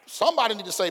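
ordinary talk

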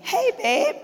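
imitated cheerful husband greeting; cheery husband. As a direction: sing out the husband's hello.

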